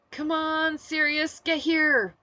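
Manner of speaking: loud, high-pitched pleading call; urgent plea. A narrator's reading. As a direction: call out as if begging for rescue. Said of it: "Storm's plea turned into a constant litany".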